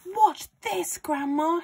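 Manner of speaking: put-on high, loud boyish call; shouting boy. A narrator's reading. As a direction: shout up excitedly to Grandma. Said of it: "he shouted".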